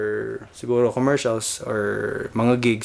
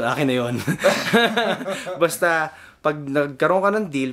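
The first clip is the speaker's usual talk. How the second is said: laughing through the words; 3 semitones higher than usual. Real, not acted. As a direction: chuckle while talking. Joking about keeping the money.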